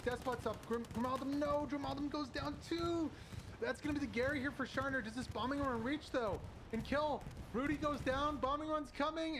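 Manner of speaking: breathless caster hype; excited play-by-play. Real, not acted. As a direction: ride the wave of the fight, building. Climactic fight for the point.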